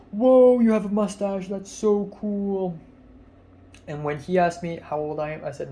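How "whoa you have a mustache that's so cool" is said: higher and much louder, cool said slowly; imitating the friend's loud delight; amazed friend. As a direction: impersonate a wowed friend gushing. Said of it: "Jonah's friend was like".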